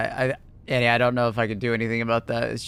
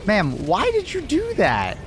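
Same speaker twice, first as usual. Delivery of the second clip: hurt indignant; 8 semitones higher, slower than usual. Real, not acted. Teammate shot him in game.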